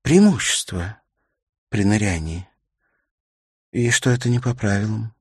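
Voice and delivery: lightly voiced widow, slightly high; touch of a distressed mother; upset widow. Widow tells of her daughter.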